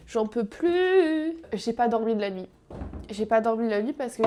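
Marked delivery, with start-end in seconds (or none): 0.1-1.3 whiny exhausted. Pretend. Exhausted sick complaint.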